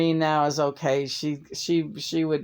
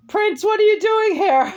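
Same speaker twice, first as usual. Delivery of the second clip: much higher, louder mock call to Prince; 7 dB louder than usual. Real, not acted. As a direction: call out to Prince, playfully startled. Teasing call to a co-host.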